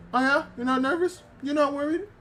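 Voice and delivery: high-pitched, disbelieving retort; mock skeptical challenge. Dog challenges her denial, retold.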